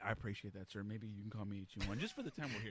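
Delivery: low, laid-back officer; casual doctor voice. Retold or imitated. Reservist doctor being casual.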